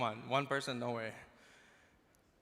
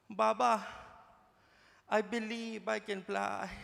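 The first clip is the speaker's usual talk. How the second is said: put-on higher, halting child with Filipino accent; 5 semitones higher, slower than usual. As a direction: plead with dad in a Filipino accent, long pauses. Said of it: "speaks English with a Filipino accent".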